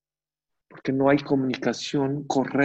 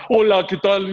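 higher, louder, blunt hello; harsh loud greeting; 6 semitones higher, 7 dB louder than usual. Shown as a demonstration. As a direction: bark the greeting to scare off the customer. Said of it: "no le puedes hablar".

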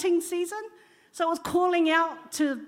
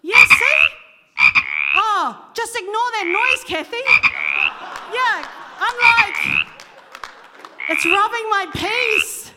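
loud over noise; 6 semitones higher, 9 dB louder than usual. Real, not acted. Live frog noise in the room.

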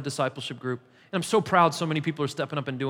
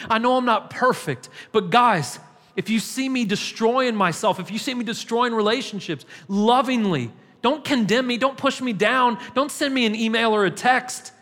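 raised, driving pulpit voice; preaching at full force; 4 semitones higher than usual. Acted. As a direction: press the plea home to the congregation.

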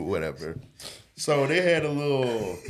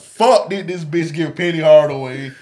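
loud, higher, incredulous; outraged disbelief; 6 semitones higher, 9 dB louder than usual. Retold or imitated. Stuck inside, loudly incredulous at the teacher. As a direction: rant in disbelief. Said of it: "I'm like".

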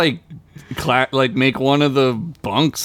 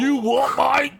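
high-pitched mock English challenge; badly faked English "mate" voice; bad English accent; 13 semitones higher than usual. Challenging someone over Matt Murdock, hammed-up English accent. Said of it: "can't do an English accent".

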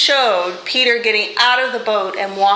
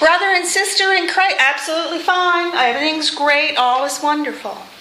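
forced cheer; 6 semitones higher than usual. Retold or imitated. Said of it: "You go".

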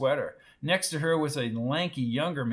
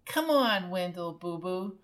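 put-on high-pitched wheedling girlfriend; coaxing sweetheart; 4 semitones higher than usual. A narrator's reading. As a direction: coo at him with a pet name. Lulu coaxes her boyfriend.

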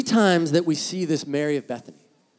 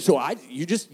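ordinary talk

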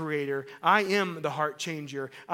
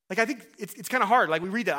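normal talking voice

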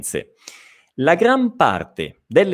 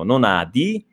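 ordinary talk

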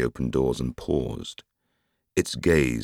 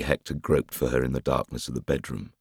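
usual speaking voice

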